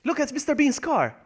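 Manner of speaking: high, delighted pointing-out; high-pitched excited exclamation; excited discovery. Real, not acted. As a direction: call out a surprise find. Excited spotting of a car.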